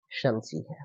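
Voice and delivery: ordinary delivery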